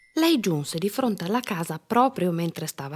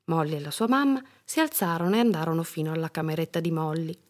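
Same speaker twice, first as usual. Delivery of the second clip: ordinary delivery, nothing marked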